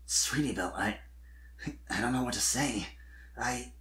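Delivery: words stall and fade out of nerve; nervous fading stammer. A narrator's reading. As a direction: Spike at a loss for words, fading away. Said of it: "nerves stalled his words as they faded".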